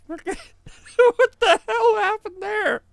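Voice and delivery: very high, slow, squeaked through laughter; helpless laughing. Real, not acted. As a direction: force the question out while cracking up. Still in laughing fit.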